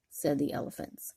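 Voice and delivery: plain reading voice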